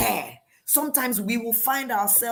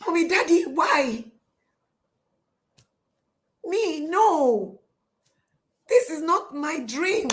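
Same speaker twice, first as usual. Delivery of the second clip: high-pitched, theatrical whimpering protest; acted wailing dismay; 8 semitones higher than usual. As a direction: wail like a girl pleading with her parents. Acting Hagar protesting to her parents.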